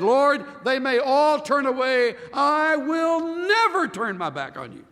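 Peter's loud, high-pitched vow of loyalty; proud vow. Retold or imitated. Peter vowing loyalty to the Lord, loud and proud. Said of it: "Peter said".

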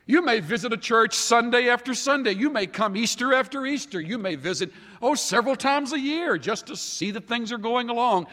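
rising sermon fervour; fervent preaching. Acted. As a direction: drive each question at the congregation. Preaching at full force.

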